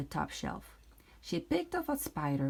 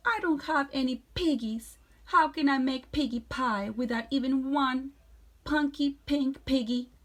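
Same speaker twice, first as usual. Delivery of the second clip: higher, louder fretting hag; whiny witch; 7 semitones higher, 5 dB louder than usual. Acted. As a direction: complain like a spoiled witch. Witch complains she lacks piggies.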